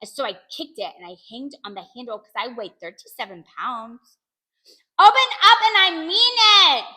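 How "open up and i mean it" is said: high, loud, drawn-out command; yelling. A narrator's reading. Child hanging on bathroom door demands entry, yelling. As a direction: holler it as an order. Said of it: "I yelled".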